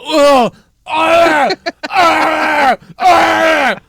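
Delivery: repeated high, loud screams; acted screaming. Reacting to someone saying gonna fly, repeated piercing screams. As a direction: scream as if driven mad by the catchphrase. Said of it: "I immediately go".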